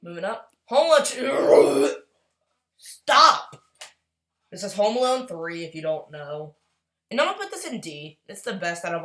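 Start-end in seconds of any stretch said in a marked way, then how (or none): 0.7-3.6 mock character voice.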